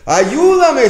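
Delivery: high, loud cry for help; pleading cry. Retold or imitated. Sick man cries out to God for help. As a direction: wail it out to God. Congregant's prayer voiced.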